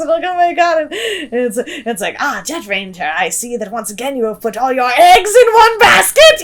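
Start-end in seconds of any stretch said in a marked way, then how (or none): 2.2-6.4 a lightly put-on German-accented chicken villain; a hint of a smug villain chicken, a touch louder. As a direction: sneer like a gloating space-chicken baddie. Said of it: "Why does it sound like a German chicken?".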